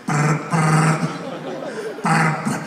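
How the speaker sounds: low trilled ringing noise; imitated phone ring.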